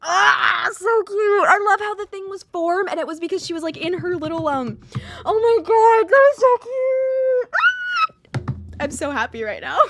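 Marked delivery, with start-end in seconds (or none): 5.2-8.8 excited squeal. Real, not acted. Gushing over cute scene.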